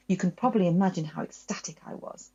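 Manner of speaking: ordinary delivery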